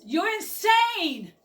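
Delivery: high, loud, slow outraged yell. Acted. Knot yells at the reckless driver, outraged. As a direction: shout it at the madman driver. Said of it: "Knot yells".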